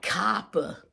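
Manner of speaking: put-on clumsy old-timey Northeast accent on copper; bad New England accent. Shown as a demonstration. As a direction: say copper in a botched Jersey-Boston accent. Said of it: "I don't know how to do an accent".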